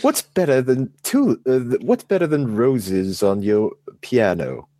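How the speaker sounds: a hammed-up entertainer's voice, badly faked. Speaker apologizes for a poor impression, then tries it: mock showman voice. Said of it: "I can't do a good [name] voice".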